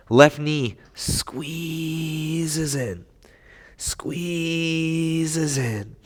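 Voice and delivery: slow soft drawn-out cue. Shown as a demonstration. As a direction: stretch the word out softly.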